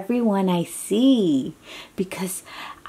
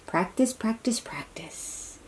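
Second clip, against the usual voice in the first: fading to whisper; 4 semitones higher, 9 dB quieter than usual. Acted. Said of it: "fading repetition".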